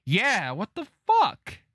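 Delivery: high, loud, drawn-out what the fuck; slow, disbelieving yell at the screen; shocked outburst. Real, not acted. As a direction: blurt it out, stunned by the twist. Shocked by Clementine leaving alone.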